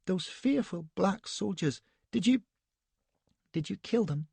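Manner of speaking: shudder through the words; Elsbeth's frightened high voice; shuddering fear. A narrator's reading. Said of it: "she shuddered violently".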